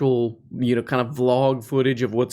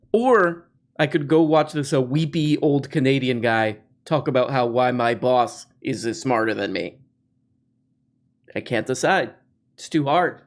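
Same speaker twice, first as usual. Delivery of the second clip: usual speaking voice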